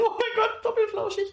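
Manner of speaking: very high squeal, laughing; laughing high-pitched cat-lover squeal; squealing delight. Retold or imitated. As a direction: gush over two fluffy cats. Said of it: "Und dann war ich so".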